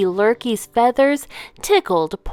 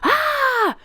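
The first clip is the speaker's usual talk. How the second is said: sharp high yelp of pain; fox's startled yelp. A narrator's reading. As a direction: yelp as the apple hits. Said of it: "he shouted".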